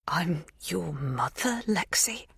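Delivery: tentative whisper. A narrator's reading. Magda's tentative revelation.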